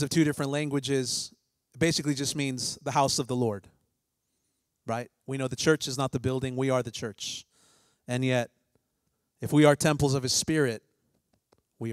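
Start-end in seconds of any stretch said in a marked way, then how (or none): none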